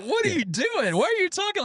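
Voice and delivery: wife reacting to his restaurant Spanish, high and exasperated; imitating his wife. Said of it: "And Linda's looking at me like".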